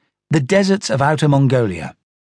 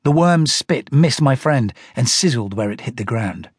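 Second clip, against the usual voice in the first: ordinary delivery, nothing marked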